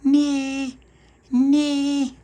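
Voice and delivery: put-on very high loud baby squeal; baby shout. Baby Lucia calling through the letterbox, high baby squeal. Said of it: "she shouted through the letterbox".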